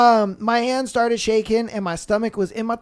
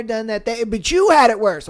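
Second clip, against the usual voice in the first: ordinary talk, nothing marked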